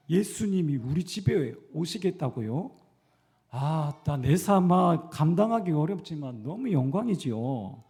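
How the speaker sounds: loud, slow Gyeongsang-dialect Zacchaeus, overjoyed. Acted. Preacher acting Zacchaeus's joy in Gyeongsang dialect, loud and slow. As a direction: play Zacchaeus in thick Gyeongsang dialect, astonished and honored. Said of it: "이 사투리로 하는 겁니다".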